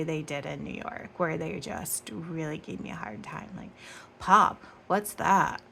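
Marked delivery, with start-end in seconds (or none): 4.2-5.6 loud, slow, scoffing; mock them like a snooty New Yorker would, scornfully loud. New Yorkers teasing her over the word pop, loud slow scoff. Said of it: "I should have said that in New York accent".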